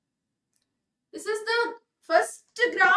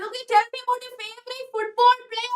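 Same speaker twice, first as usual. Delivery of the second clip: higher-pitched teacher putting a question; lightly sung-out, higher classroom question; teacher's casual question; 6 semitones higher, 3 dB louder than usual. Acted. Teacher asking students about something else, acted question. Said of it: "വേറെ വല്ല കാര്യം ചോദിച്ചു".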